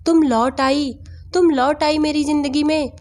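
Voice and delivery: flustered. A narrator's reading. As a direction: exclaim it high, overwhelmed that she is back. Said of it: "घबराते हुए बोले".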